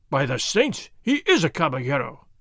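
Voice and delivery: plain reading voice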